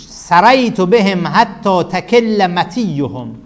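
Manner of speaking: slow, drawn-out verse chant; reciting Arabic verse. Shown as a demonstration. Teacher recites the poet's verse to the class, slow chant. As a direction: intone the poet's line slowly. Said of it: "صدر بیت این هستش".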